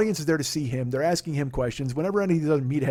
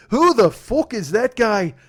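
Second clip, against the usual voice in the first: McGregor put-down; 6 semitones higher, 7 dB louder, slower than usual. Retold or imitated.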